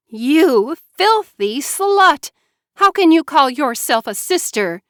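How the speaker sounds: Mara Joy spitting venom at her sister; venomous. A narrator's reading. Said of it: "venom in her voice".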